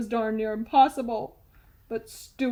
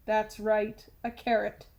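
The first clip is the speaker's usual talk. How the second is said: usual speaking voice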